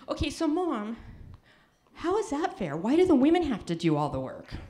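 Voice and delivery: mock the kid's indignant question; lightly voiced indignant daughter; daughter's voice. Daughter's voice quoted in story.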